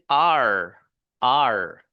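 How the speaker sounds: "आर" drawn out with a smooth untrilled r; English R. Shown as a demonstration. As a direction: model "आर" slowly without a roll. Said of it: "आर का जो sound है". (बिना लुढ़काए "आर")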